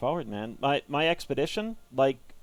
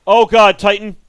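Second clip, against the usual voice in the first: loud startled cry; alarmed exclamation; 7 semitones higher, 15 dB louder than usual. Real, not acted. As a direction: blurt it out, alarmed. Giant enemy appears.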